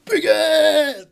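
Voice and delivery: very high, loud referee's yell; shouted start call. Acted. Hobgoblin starting the fight, full shout. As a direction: bellow the start of the bout. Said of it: "'cause he's shouting so much".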